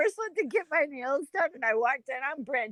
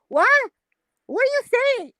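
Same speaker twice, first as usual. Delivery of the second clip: high-pitched, re-enacted puzzled question; retold baffled client; 10 semitones higher, 4 dB louder than usual. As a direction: ask like a lost customer, retelling it. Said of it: "Sometimes I go".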